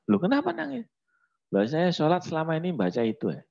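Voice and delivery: high, fast dismayed exchange; wife asks, husband admits, high and quick; story figure voice. Acted. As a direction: pitch it up and hurry it. Dialogue of crying father.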